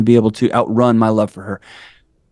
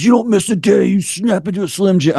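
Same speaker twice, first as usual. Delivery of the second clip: much higher, hammed-up gym-bro slogan; mock gym bro; 8 semitones higher than usual. Mocking his old gym routine to a podcast host, hammed-up gym bro. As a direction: play the grind-culture tough guy, tongue in cheek.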